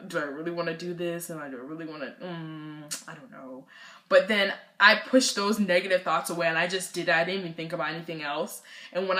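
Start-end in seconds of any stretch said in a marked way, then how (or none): 0.1-3.7 soft, low thinking aloud; retold doubts, fading very soft and low; reluctant inner voice. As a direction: hesitate quietly, as if to oneself. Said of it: "in my head, I was like".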